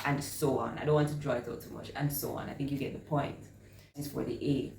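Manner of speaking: usual speaking voice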